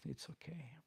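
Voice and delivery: whispered, giving up; resigned whisper. Retold or imitated. Whispering to self after losing fifty-four euros. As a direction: let it go under your breath. Brushing off the lost money.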